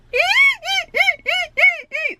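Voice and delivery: very high, girly giggle, imitating someone; imitated high-pitched laugh. Mimicking the store manager's real laugh, high girly giggle. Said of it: "I could imitate his laugh pretty good".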